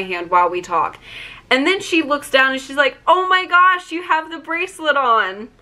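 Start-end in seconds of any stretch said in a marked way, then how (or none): 3.1-5.5 high-pitched excited gasp of recognition, imitated; delighted surprise. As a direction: re-enact the star's thrilled discovery. Star spots bracelet, retold.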